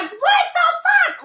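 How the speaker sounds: retold shocked shout, pitch jumping way up; shocked yell. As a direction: yell it like someone just shoved fingers in your mouth. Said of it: "And I was like".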